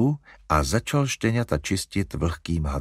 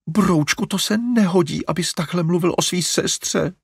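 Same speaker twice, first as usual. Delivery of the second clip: higher, plaintive mother's wail; a mother's dismayed cry, pitched up; 10 semitones higher, 3 dB louder than usual. A narrator's reading. Mother scolding her son about his sister, wailing. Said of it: "zaupila maminka".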